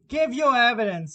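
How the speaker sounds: loud, high royal command; commanding king. A narrator's reading. King demands the cook's evidence, loud and high. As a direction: bark the order from the throne.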